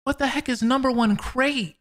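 normal talking voice

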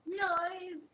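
sudden shout; bright shouted noise; loud playful shout. Real, not acted. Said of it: "your finding a way now noise".

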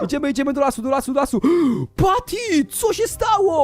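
high, hurried rallying cry; urgent alarm. Pretend. Hero rushing into the forest for Patty, urgent alarm. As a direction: rush it out high, alarm mounting to a gasp.

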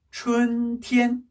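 "춘티엔" very slow, two level held notes; double first tone. Shown as a demonstration. Teacher drilling spring, "춘티엔" slow and level. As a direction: chant "춘" and "티엔" steadily on one pitch. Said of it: "이게 일 성, 일 성이에요".

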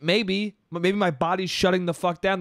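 ordinary talk, nothing marked

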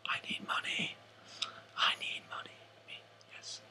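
very soft mock-pleading whisper. Host asking McDonald's to sponsor him, whispered. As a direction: whisper it like a secret plea.

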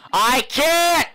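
high frustrated yell; panicking gamer. Real, not acted.

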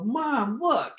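put-on excited kid. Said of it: "I turn to my mom and I go".

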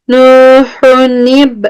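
Nühünib recited slowly with the letters joined; Nühünib joined in a slow Quranic reading; tajweed demo. Shown as a demonstration. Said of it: "Hu dedim".

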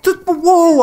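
high, loud wonder; amazed whoa. Real, not acted. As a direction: blown away by the moment. Amazed at stolen horse.

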